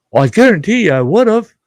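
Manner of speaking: loud, high, insistent; hammed-up protest with the voice shooting up; emphatic assurance. Retold emphatic reply about missed gift.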